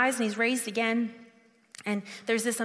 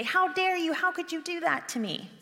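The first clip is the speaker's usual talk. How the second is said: mock angry rebuke; 7 semitones higher than usual. Pastor voicing a blaming Jesus, mock angry. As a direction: hurl the blame back at them, half acted. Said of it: "He could shout at them".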